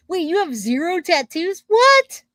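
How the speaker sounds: shocked high What; surprised. Real, not acted. Shocked that a viewer has zero tattoos, surprised. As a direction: blurt it out in disbelief.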